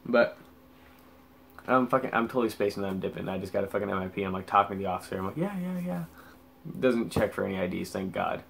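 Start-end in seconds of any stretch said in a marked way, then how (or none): 5.4-6.0 high, soft placating yeahs; small high-pitched retold yeahs; dismissive agreement. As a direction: brush off the officer with quick agreement. Topping the officer off while spaced.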